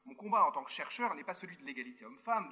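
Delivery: ordinary delivery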